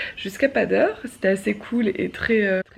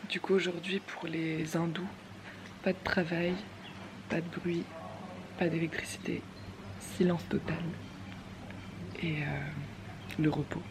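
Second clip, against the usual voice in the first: quiet; 12 dB quieter than usual. Real, not acted. Quiet talk on silence day.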